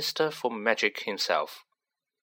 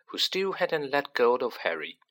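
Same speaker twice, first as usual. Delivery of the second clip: ordinary delivery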